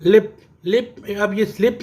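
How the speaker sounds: ordinary talk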